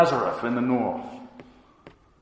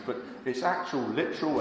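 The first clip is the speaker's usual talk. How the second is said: normal talking voice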